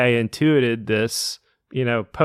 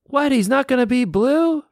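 surprised; 12 semitones higher than usual. Retold or imitated. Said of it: "They were like".

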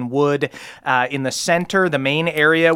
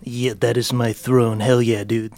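put-on cool teen gang leader; 3 semitones lower than usual. Game master plays Ruskak, teen leader.

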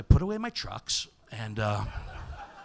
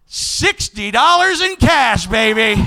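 high, shouted victory crow; triumphant bragging; 14 semitones higher, 13 dB louder than usual. Pretend. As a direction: belt it out like a jackpot winner. Bragging onstage about winnings.

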